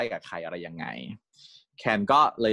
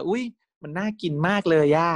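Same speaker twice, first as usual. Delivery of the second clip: higher, louder, slowed delight; excited; 8 semitones higher, 4 dB louder than usual. Retold or imitated. Can gushing over the snack Tin brought, excited. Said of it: "ใส่แบบตื่นเต้นกับอีขนมอยู่".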